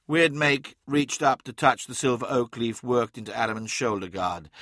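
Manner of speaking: ordinary delivery, nothing marked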